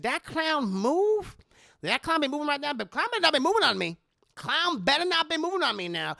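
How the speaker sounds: high, loud nervous warning; alarmed wariness. Acted. Madea seeing the clown get closer, high alarmed warning. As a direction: pitch it up, mock-spooked and challenging.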